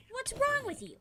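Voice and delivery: high, loud, incredulous yell; sister yelling. Retold or imitated. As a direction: bark it at him like an exasperated sister. Sister scolding on the phone.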